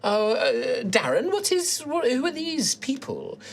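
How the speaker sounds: high, fluttery grandma voice, rising in surprise; flustered old halfling woman. Acted. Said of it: "she's like".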